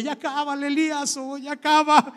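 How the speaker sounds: mock pleading. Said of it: "estaban llorando porque".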